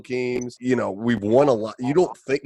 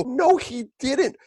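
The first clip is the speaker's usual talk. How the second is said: retold protest; much slower than usual. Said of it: "I go".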